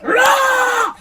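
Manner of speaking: very high, loud battle cry "Rah!"; pumped patient roaring on the hospital bed; hyped roar. Retold or imitated. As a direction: let out a charged-up roar. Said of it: "I was like".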